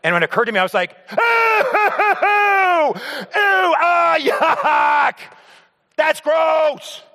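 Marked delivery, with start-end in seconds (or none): 1.1-7.0 disgusted shriek. Retold or imitated. Son finding urine-soaked towels, shrieking in disgust. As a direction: squeal it out, grossed out.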